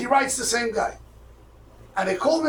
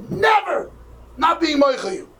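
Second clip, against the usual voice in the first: high, loud retold outburst; vehement refusal; 9 semitones higher, 4 dB louder than usual. Dying man seeing the visitor come in, vehement refusal. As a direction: cry out the refusal, pitched way up.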